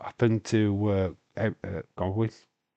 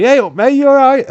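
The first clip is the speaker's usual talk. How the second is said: much higher, loud broad Yorkshire greeting; broad Yorkshire accent; 13 dB louder than usual. Pretend. Streamer imagining greeting a wrestler, shouted broad Yorkshire accent. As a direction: belt it out in a thick Yorkshire accent. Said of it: "Broad Yorkshire accent coming through".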